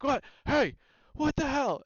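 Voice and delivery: high, loud alarmed yell; startled shout. Acted. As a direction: bark it out in alarm. Captain reacting to commotion.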